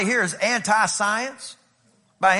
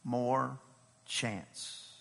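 soft, low, gentle plea; quiet pleading; 10 semitones lower, 10 dB quieter than usual. Acted. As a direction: plead quietly for one more chance. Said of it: "The dresser of the vineyard said".

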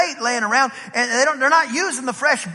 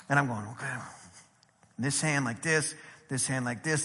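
very soft, low self-instruction; talking to himself; 9 semitones lower, 12 dB quieter than usual. Retold or imitated. Fisherman rehearsing cast-net steps to himself, hushed and low. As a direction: murmur the steps under your breath.

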